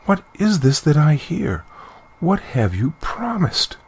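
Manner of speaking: high-pitched, plaintive questioning; acted tearful dismay, voice lifted high; weeping. A narrator's reading. Queen questions her daughter's promise, weeping.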